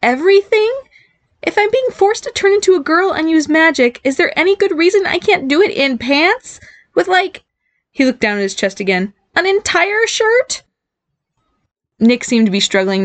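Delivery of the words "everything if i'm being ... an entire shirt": high, incredulous squeaky voice; outraged girlish disbelief; disbelieving. A narrator's reading. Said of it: "in disbelief".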